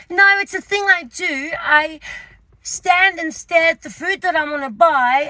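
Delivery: high, emphatic explanation; insistent excuse. Retold or imitated. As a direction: imitate earnestly justifying an odd habit. Retold excuse to shop assistant.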